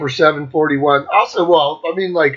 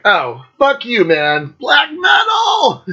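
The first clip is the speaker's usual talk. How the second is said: slow build into a high held shout; mock metal yell; 8 semitones higher, slower than usual. Thrilled fan greets Mantis from Venom, yelling high. Retold excited cry at meeting Mantis.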